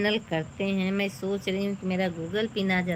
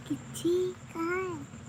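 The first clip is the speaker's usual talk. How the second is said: put-on high little girl voice, much higher, laughing in the middle; child voice; 8 semitones higher than usual. Mother playing her little daughter, high child voice. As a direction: speak as the small daughter, high and babyish.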